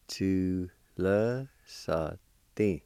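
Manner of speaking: "Tu le sa din" drawn out very slowly; syllable by syllable. Shown as a demonstration. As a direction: hold each syllable of "Tu le sa din" apart. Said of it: "It's pronounced like this".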